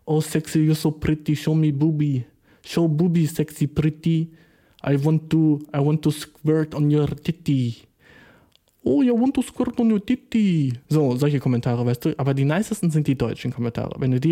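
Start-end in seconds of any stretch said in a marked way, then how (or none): 0.1-5.6 Indian-accented creepy commenter imitation; light mock Indian accent, slightly lifted. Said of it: "oder fucking indische Dudes". 5.8-7.8 slow mock Indian accent; slow, Indian-accented commenter voice. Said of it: "oder fucking indische Dudes". 8.8-10.7 high, hammed-up Indian accent; high-pitched mock Indian voice; exaggerated Indian accent. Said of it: "oder fucking indische Dudes".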